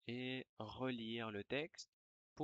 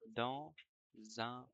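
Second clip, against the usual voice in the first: dictating. Shown as a demonstration. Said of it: "je vais dicter une dictée".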